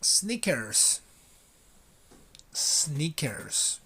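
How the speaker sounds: Sneakers said clearly for the class to copy; Sneakers, with a crisp k; model pronunciation. Shown as a demonstration. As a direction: model Sneakers for them to repeat. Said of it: "Repeat after me".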